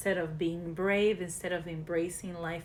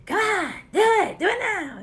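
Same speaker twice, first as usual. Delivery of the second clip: much higher, loud mock coach yell; screaming mean coach; 14 semitones higher, 10 dB louder than usual. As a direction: shriek at the player like a mean coach. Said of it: "that's always screaming at you".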